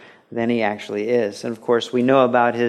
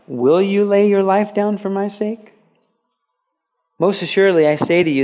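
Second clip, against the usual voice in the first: preached scripture reading; 7 semitones higher, 4 dB louder than usual. Acted. Reading Jesus' words.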